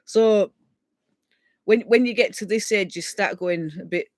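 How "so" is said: normal talking voice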